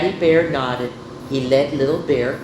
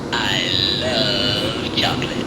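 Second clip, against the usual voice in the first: much lower, slow and drawn out; put-on deep voice; 9 semitones lower, slower than usual. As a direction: drop into a slow, deep mock voice. Demonstrating her love of chocolate.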